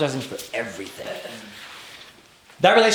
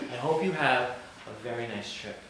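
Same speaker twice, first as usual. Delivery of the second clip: softer, restrained voice for a retold mother; pointed mother send-off; 2 semitones lower, 9 dB quieter than usual. Mother to guilty son leaving on a trip, quietly pointed. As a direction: say it quietly, loaded with knowing. Knowing mother sends him off.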